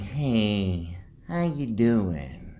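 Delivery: slowed, sugary put-on greeting; the out-of-it nurse greets the patient, sugary and slow; mock sweet nurse. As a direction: imitate a nurse trying too hard to be nice. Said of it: "she's trying to be sweet".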